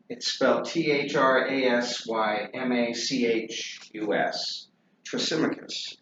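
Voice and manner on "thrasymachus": Thrasymachus repeated softly as thruh-SIM-uh-kus; pronunciation demo. Shown as a demonstration. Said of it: "His name is".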